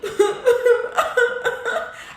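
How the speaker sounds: loud high-pitched mock sobbing; imitated crying. Woman showing how she cried before her birthday, mock sobbing. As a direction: wail in short, high bursts, acting out a cry. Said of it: "this shit I was just doing. It was".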